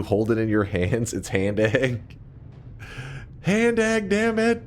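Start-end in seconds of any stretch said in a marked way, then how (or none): 1.1-2.2 slow deadpan. Real, not acted. Slow punchline. 3.4-4.6 insistent outburst. Real, not acted. Heated insistence.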